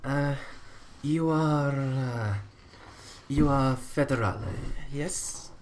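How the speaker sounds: put-on halting Sicilian-accented stranger feeling out an agent; hesitant, pause-filled Sicilian accent; bad Sicilian accent. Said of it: "attempting to do a very bad Sicilian accent".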